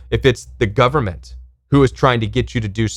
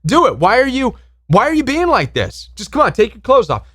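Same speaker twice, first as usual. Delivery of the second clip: pushy pressuring voice; 9 semitones higher than usual. Retold or imitated. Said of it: "You're like".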